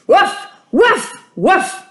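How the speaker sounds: high, loud dog barks. A narrator's reading. Mister Dog barking at the door to lure Hussan, loud high barks. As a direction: bark as hard as you can. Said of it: "barked as loudly as he could".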